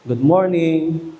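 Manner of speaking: a lightly voiced deep-voiced man greeting; slow, low-pitched mock greeting; first man's voice. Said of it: "iba-iba yung boses".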